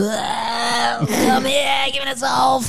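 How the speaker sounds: put-on high, rowdy drunken yelling; mock the bawling drunks, high; drunk partygoers. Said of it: "Und die so". (grölende Sauftypen, hoch)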